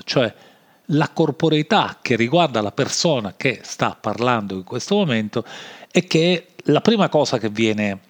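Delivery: ordinary delivery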